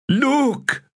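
very high, drawn-out plea; pleading command. Acted. A cheater points at the dice again, pleading. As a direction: beg them to look. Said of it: "the command sounded more like a plea".

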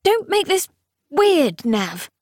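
high, strained pathetic protest. Acted. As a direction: squeak it out, flustered and pathetic. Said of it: "the rather pathetic".

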